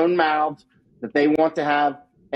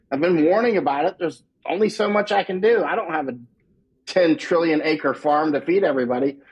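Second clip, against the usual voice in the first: usual speaking voice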